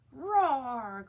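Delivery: loud dinosaur roar. A narrator's reading. Froggy playing T-Rex, loud roar. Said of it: "cried Froggy".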